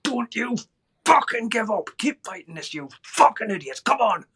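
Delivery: high furious yelling at the medics; angry shouting. Retold or imitated. Dying man yelling at the medics, high furious shout. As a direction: roar at them to keep fighting. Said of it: "my angry voice going".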